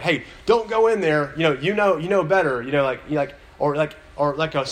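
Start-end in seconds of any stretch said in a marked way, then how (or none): 0.0-2.6 yelling at the screen. Retold or imitated. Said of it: "you're like".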